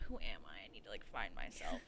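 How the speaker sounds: low, dragging mock angst; mock soul-searching. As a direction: parody someone finding themselves. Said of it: "type shit".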